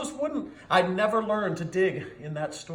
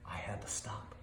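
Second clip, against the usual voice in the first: hushed; 9 semitones lower, 15 dB quieter than usual. A narrator's reading. Sudden halt in story.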